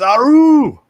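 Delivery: high, loud, drawn-out whoop; Spartan war cry. Acted. Host echoes a viewer's chant, loud Spartan war cry. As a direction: belt it out as a battle cry.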